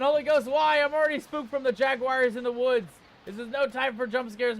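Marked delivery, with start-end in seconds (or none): 0.0-2.3 high, loud startled cry; startled shout. Real, not acted. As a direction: cry out in shock. Second jump scare reaction.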